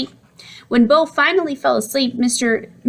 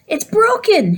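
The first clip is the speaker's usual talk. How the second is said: exclaiming; 11 semitones higher, slower than usual. A narrator's reading. Boy exclaiming the TV is broken. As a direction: blurt it out in alarm. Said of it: "he exclaimed".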